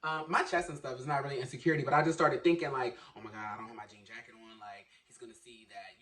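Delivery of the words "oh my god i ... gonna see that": fast, much softer and lower worried murmur; anxious inner thought. Retold or imitated. Worrying in his head at work about having no jean jacket. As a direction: fret quickly under your breath.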